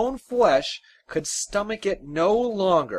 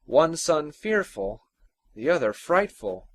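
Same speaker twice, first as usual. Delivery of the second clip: plain reading voice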